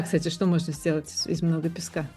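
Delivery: normal talking voice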